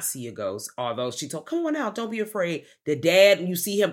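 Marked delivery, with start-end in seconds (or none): 1.4-2.6 high, gentle coaxing call. Retold or imitated. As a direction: coax a scared girl out of hiding, voice up high. Coaxing a hiding girl.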